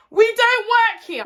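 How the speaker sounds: high, loud retold protest; exasperated shout. To a passer-by asking about the ATM, exasperated shout. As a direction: shout it through the glass.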